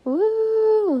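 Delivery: high, loud whoop of excitement; excited whoop. Real, not acted. Host whooping to open her solo podcast episode, excited and high. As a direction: cheer as the episode kicks off.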